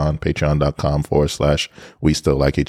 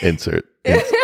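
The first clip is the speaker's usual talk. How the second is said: laughing. Real, not acted. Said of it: "insert Bronx voice".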